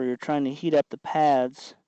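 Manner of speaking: normal talking voice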